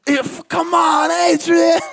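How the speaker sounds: very high, loud strained mock shout; hammed-up, over-the-top movie wail; Rocky. Showing how Rocky doesn't yell, high exaggerated shout.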